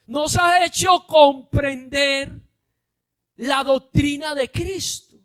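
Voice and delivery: loud, high preacher's shout; preaching at full force. Acted. Preacher shouting the point to his congregation. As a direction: drive it home to the congregation.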